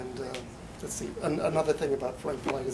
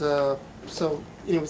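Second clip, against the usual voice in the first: usual speaking voice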